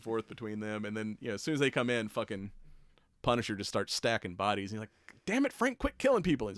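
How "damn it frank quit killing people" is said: higher, louder mock scold; exasperated scolding. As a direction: snap at him like a fed-up partner. Daredevil scolding Frank, retold.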